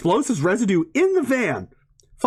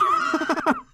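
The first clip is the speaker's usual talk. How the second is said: sudden high-pitched giggle; high squeaky laugh. Real, not acted. Laughs at losing his thread.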